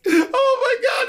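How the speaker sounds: high, crying with laughter; real laughing fit, voice cracking high; laughing shriek. Real, not acted. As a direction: squeal it out through laughter.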